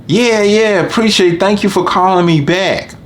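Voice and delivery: high, overly sweet customer voice; customer on the phone. Retold or imitated. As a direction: mimic a customer brushing you off politely, pitched high.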